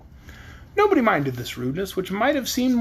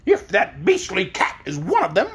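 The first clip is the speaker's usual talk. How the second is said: scornful; 3 semitones higher than usual. Acted. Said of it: "said Billina scornfully".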